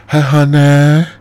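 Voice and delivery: put-on very high, loud Chinese accent. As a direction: shout a salon offer, Chinese accent. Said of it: "I hate to be doing Chinese".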